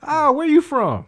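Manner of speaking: very high, loud, drawn-out question; hammed-up excited curiosity; mock delighted officer. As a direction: squeal it with exaggerated delight.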